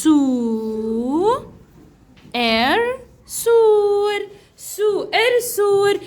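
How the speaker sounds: slow, held "سو", "إر", then blended "سور"; syllable sounding-out. Shown as a demonstration. Teacher writing a word for pupils, slowly sounding out "سو إر سور". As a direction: draw out "سو" and "إر" separately, join as "سور".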